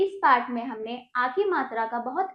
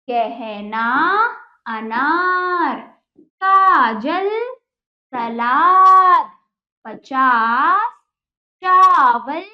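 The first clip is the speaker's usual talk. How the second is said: carefully spaced word-by-word reading, louder than her chat; dictation voice; 10 dB louder, much slower than usual. Acted. Teacher to children, modelling each word for repetition. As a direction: read each word for the class to echo.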